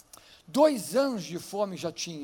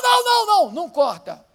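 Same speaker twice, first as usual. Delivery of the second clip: pleading mother; 9 semitones higher, 12 dB louder than usual. Retold or imitated. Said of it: "E a outra".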